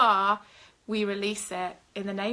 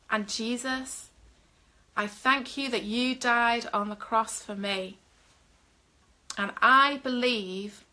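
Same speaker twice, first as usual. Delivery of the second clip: slow, measured prayer with long pauses; leading a prayer. Acted. Minister leads online viewers in a salvation prayer, slow and solemn. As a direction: pray it line by line for them to repeat.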